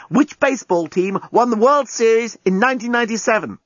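high-pitched pressing question; urgent cry. Acted. Lucy distracting the bomb, urgent high cry. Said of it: "cried Lucy to the bomb".

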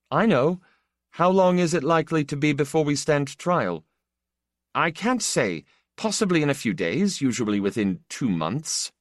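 a lightly voiced magistrate, matter-of-fact; polite official. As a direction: answer courteously from above, tired and factual. Polite official answers prisoner.

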